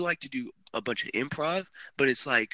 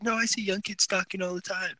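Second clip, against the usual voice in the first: higher-pitched mom's retort; imitating mom; 8 semitones higher than usual. Retelling mom's objection to him.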